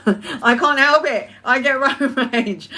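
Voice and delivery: slow, laughing confession. Real, not acted. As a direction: giggle through the admission. Laughing about her own road rage.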